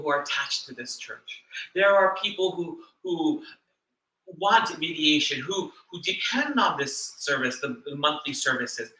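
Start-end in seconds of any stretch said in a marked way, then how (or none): none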